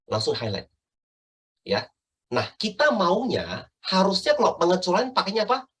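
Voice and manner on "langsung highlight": ordinary talk, nothing marked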